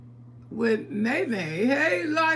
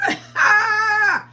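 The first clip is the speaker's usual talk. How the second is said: 大きな高い笑い声; short loud high cackle; loud high laugh; 9 semitones higher, 9 dB louder than usual. Real, not acted. Laughing at viewer.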